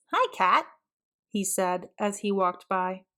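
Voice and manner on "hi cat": put-on high, friendly kid greeting; Archie greeting. Archie greets the new cat, cheerful and high. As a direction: toss a light hello at the cat.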